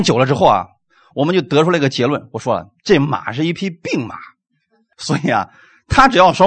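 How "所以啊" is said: ordinary talk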